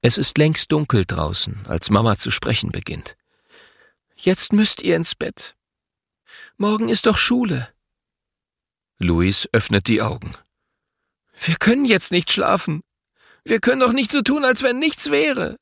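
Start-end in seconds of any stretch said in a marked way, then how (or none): 4.2-7.7 lightly voiced mother, higher pitched; a gentle mother sending kids to bed. A narrator's reading. 11.4-15.6 put-on higher child voice, rushing and upset; a grieving boy protesting bedtime. A narrator's reading.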